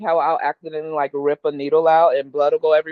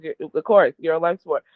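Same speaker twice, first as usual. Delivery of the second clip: normal talking voice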